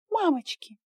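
high frightened squeak. A narrator's reading. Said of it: "пискнула за его спиной Инга".

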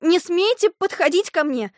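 high, loud warning cry; frightened shout. A narrator's reading. Girl warns off the approaching stranger, frightened shout. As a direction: shout to keep him away. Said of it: "закричала вдруг Алиса".

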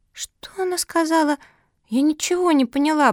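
high, piping little voice; puzzled squeak. A narrator's reading. Little pug Cookie puzzled by philosophy, squeaky. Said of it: "пропищала Куки".

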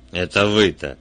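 inspector's understated growling jab; growled retort. A narrator's reading. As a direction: throw it back with a touch of a growl. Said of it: "прорычал Кремер".

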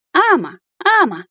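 high creature call; dragon speech. A narrator's reading. Dragon reaching a paw toward the fairy, calling. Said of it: "произнёс дракон".

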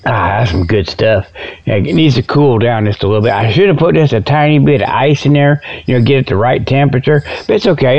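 normal talking voice

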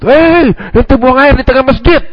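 high, slow alarmed yell; alarmed shouting. Retold or imitated. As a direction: cry out in shock at the man. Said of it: "itu orang pada teriak menjerit".

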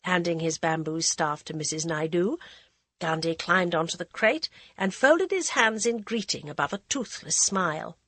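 plain reading voice

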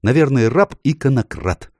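high-pitched indignant name-calling; scornful, high-voiced scolding. A narrator's reading. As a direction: throw insults, affronted. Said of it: "возмутилась незнакомка".

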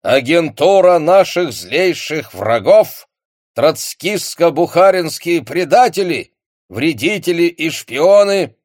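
loud, slow, high solemn radio speech; Marshal Voroshilov. Acted. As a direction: boom it out like a Soviet broadcast. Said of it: "Маршал Ворошилов из репродуктора продолжал докладывать".

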